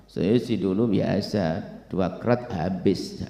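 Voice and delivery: low, soft, slow drawl; casual boaster. Acted. Former drinker tells of old habits, slow low boast. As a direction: drag it out low and offhand.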